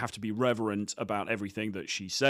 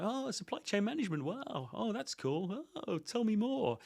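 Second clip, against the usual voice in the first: high, softer fake enthusiasm of a pushover; nodding dog chump; 11 semitones higher, 6 dB quieter than usual. Mocking the eager nodding guy, fake wowed voice. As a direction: gush like a spineless yes-man. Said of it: "that nodding dog chump who's like".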